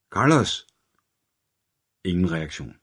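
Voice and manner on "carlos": high shout of the name; detective shouting his partner's name. A narrator's reading. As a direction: try to wake the dying man. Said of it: "råbte Hunter igen".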